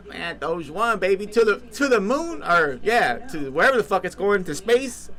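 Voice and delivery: rising, loud hype cheer; excited cheer. Real, not acted. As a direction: hype it up to the moon. Cheering Doge to the moon.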